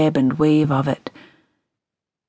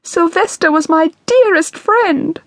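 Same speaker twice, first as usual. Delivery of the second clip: much higher, tearful pleading; imploring grief; 13 semitones higher, 4 dB louder than usual. A narrator's reading. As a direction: mourn her friend, clinging to her. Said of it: "she cried imploringly".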